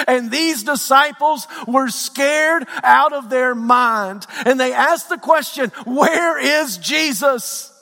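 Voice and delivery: high, drawn-out sermon cry; slow, high-pitched pulpit intensity; impassioned preaching. Acted. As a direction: stretch the words out with urgent preacher's force. Preaching at full force.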